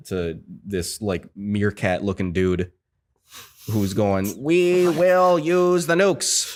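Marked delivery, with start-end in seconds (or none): 4.4-6.6 high, drawn-out mock ambassador voice; stilted cartoon character. Mocking the meerkat-looking ambassador, stilted cartoon character. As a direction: stretch every word in a put-on cartoon voice. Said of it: "like a cartoon character from".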